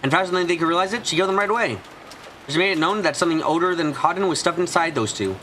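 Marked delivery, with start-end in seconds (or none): none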